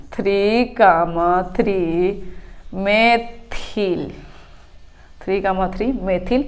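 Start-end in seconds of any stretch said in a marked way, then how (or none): 0.1-4.1 each word drawn out slowly for notes; slow, deliberate dictating pace; slow dictation. Shown as a demonstration. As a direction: spell it out slowly so students can write. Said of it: "तो हम लिखेंगे".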